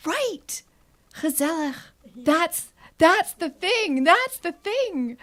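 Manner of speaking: high, slow, savouring each word; delighted realisation. Retold or imitated. Retold inner thought, lead-in "I thought.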